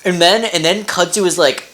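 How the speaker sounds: ordinary talk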